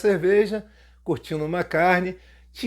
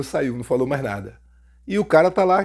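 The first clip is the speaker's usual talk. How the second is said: normal talking voice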